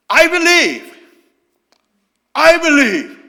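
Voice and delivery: loud, desperate shout of faith; a father screaming his faith to Jesus; father crying out. Acted. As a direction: cry it out at full voice, pleading. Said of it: "아버지가 소리를 질러요".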